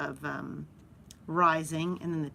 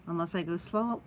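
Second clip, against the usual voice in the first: ordinary talk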